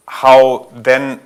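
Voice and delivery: ordinary talk, nothing marked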